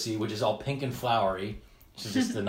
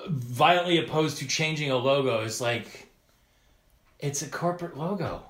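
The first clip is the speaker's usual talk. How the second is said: ordinary talk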